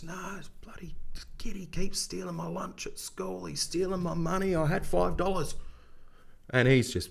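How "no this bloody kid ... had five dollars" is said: put-on high, whispery voice of a tearful small boy; crying little kid. Robbed little kid telling the older lad, tearful high whisper. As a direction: play the bullied kid choking out his story. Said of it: "And he goes".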